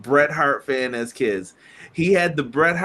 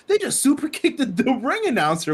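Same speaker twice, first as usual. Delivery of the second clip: put-on surprised kid; 9 semitones higher than usual. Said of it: "I was like".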